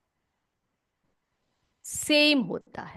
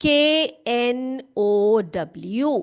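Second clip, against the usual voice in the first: slow spelling; much slower than usual. Shown as a demonstration. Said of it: "यस, नो".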